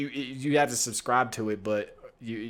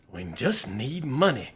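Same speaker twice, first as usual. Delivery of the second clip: ordinary delivery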